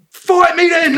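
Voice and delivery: high, loud mock yell; hammed-up shouted challenge; drunk challenger. Drunk bloke daring Tom to fight, mock yell.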